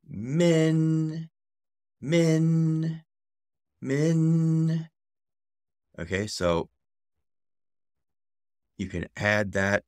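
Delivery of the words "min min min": "Min" held high, higher than the teaching voice; second-tone "Min" rising high. Shown as a demonstration. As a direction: sing "Min" from mid up to the top. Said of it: "a rising tone... level three going up to level five".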